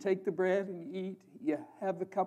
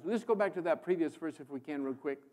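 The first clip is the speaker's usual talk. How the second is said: usual speaking voice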